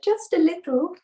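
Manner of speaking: small, high-pitched admission; child Ganesh confessing. Acted. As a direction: own up in a high little child voice. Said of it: "He said".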